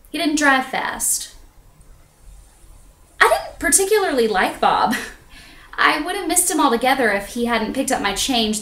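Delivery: measured, confessional stage delivery with pauses; theatrical monologue. Acted. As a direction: tell it as a solo stage confession.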